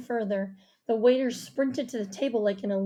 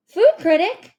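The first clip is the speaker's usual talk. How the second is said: loud, high excited question; excited shout; 9 semitones higher, 12 dB louder than usual. A narrator's reading. Rishi hearing about mom's new job, shouting excitedly. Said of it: "Rishi shouted excitedly".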